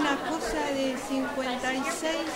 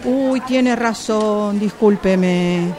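calling out; 8 dB louder than usual. Acted. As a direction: stretch it out loud so the customer hears from afar. Shopgirl calling across the store. (llamando en voz alta y lenta a la clienta)

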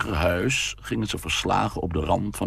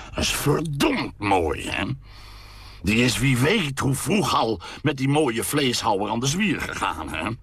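bitter sneer; 7 semitones higher than usual. A narrator's reading. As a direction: drag out the sarcasm, sneering. Father sneering about his daughter.

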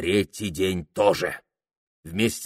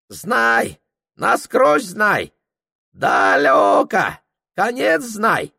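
put-on high, broken-Russian old Tungus voice; a much higher Tungus character voice; Tungus guide; 12 semitones higher, 4 dB louder, much slower than usual. Tungus greeting Prokhor in a high character voice.

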